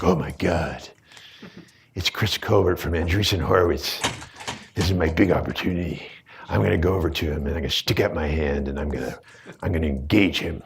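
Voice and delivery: low, hushed creepy voice; hushed eager stalker murmur; predatory menace. Pretend. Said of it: "Why do I sound so creepy?".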